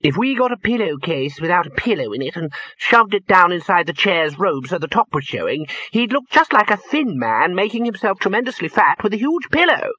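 high voice climbing with eagerness; enthusiastic. A narrator's reading. Wizard pitching pillow disguise, very enthusiastic. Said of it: "said one of them enthusiastically".

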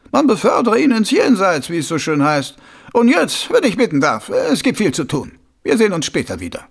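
a scheming noble, savouring his idea; sly, high-pitched lordly voice; scheming Lord Schnappüber. Acted.

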